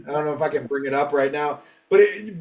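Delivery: ordinary delivery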